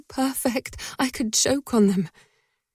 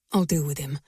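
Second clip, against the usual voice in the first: dangerous confidence; 7 semitones lower, faster than usual. A narrator's reading. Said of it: "dangerous confidence".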